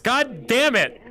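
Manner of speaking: ordinary talk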